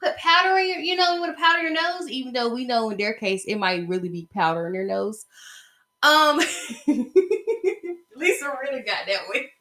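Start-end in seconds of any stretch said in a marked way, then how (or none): 0.0-2.1 a mock polite scolding, pitched up; mock sassy lecture. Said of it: "I'm like". 6.4-8.0 a short real laugh at her own joke; laughing at the powder joke; amused laugh. Real, not acted.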